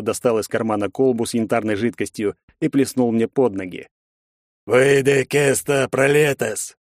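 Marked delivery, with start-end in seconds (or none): 4.7-6.8 incantation. Acted. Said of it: "пробасил отец заклинание".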